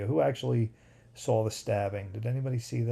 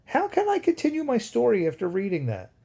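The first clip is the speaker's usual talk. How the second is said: incredulous; 9 semitones higher, 3 dB louder than usual. Real, not acted. Reacting to the Chuck Norris comment.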